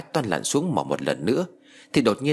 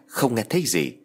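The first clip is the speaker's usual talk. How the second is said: ordinary delivery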